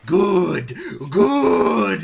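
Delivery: loud delighted cheer; gleeful cheer. Real, not acted. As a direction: cheer with glee.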